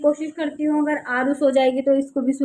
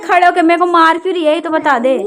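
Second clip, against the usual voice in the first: loud, higher exasperated demands; scolding; 4 semitones higher, 8 dB louder than usual. Real, not acted. Mother scolds child hitting her. (झुँझलाकर ज़ोर से डाँटना)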